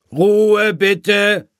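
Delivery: loud, high, sustained shout; ringing high-pitched chairman's call; calling for order. A narrator's reading. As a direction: call the meeting to silence at full voice. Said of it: "rief Herr Dachs".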